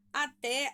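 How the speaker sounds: Até with a loud final té; stress on last syllable. Shown as a demonstration. Teacher showing the class the tonic syllable, Até stressed on té. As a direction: lean hard on the té in Até. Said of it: "a sílaba tônica é o té".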